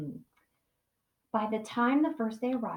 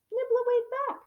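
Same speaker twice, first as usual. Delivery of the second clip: playful doll voice; 13 semitones higher than usual. A narrator's reading. Doll action read high.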